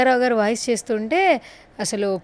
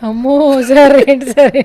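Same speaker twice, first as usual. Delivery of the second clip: amazed; 2 semitones higher, 8 dB louder, slower than usual. Real, not acted. Stunned by the impression.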